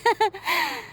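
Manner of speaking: nervous high little laugh; sheepish giggle; awkward laugh. Acted. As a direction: laugh to cover being caught. Caught eavesdropping, awkward.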